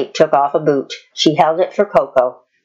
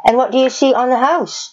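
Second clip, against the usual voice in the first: higher and quick, a teasing quiz; Cowgirl Kate's voice; 9 semitones higher, faster than usual. A narrator's reading. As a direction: lead the horse to the answer brightly. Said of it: "she asked".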